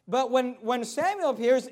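normal talking voice

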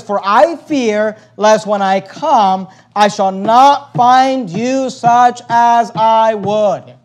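very slow, weighty proclamation; declamatory scripture reading. Acted. Preacher reading the leader's warning, slow and emphatic. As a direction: stretch out each word of the verse. Reads verse twenty slowly, loudly.

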